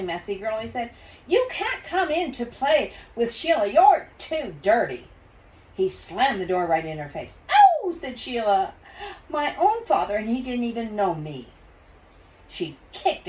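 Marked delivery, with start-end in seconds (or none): 1.3-5.1 scolding father. Acted. 7.5-11.5 dismayed. Acted. Sheila dismayed.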